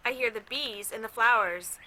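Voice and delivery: put-on gentle read-aloud to a child; calm storybook narration; story reading. A narrator's reading. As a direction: read it like a picture book at bedtime. Reads a picture book aloud.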